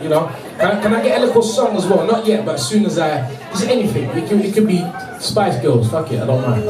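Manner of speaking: usual speaking voice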